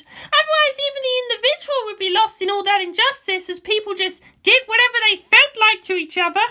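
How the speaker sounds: put-on a young girl arguing her point; Asuka's voice. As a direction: raise the voice to play the headstrong girl. Asuka protests, voiced higher.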